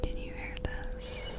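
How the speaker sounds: very soft whisper; hushed hearing test. Acted. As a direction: whisper it as a faint hearing check. Testing hearing from behind.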